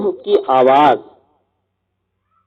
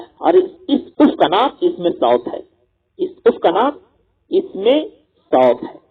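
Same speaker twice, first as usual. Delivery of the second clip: usual speaking voice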